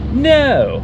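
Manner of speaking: sharp shouted refusal; stern border guard. Acted. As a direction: bark a flat no like a guard.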